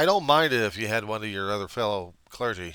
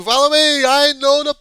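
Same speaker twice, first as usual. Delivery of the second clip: high, loud rallying cry; shrieked call to follow, far higher than usual; mock leader; 18 dB louder than usual. Leader calling everyone toward the cliff, high mock shout. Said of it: "because the leader's saying".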